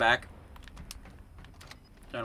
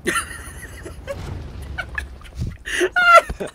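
high, slow words through laughter; helpless laughter. Real, not acted. Friend reacting to unused Venom design, helpless laughter. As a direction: struggle to talk while cracking up.

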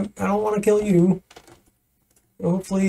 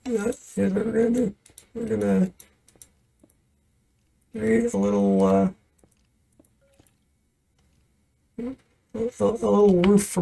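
softer, quick hushed plan; hushed planning; 6 dB quieter than usual. Real, not acted. Player hiding from the Enderman, hushed and hurried. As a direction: keep it down so the monster won't notice.

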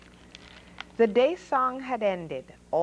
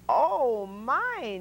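a startled, raised "Oh my"; alarmed cry; 4 semitones higher than usual. A narrator's reading. Noah alarmed at the tipping ark, a startled cry. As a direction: gasp it out as the ark tips. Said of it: "Noah s-, cried".

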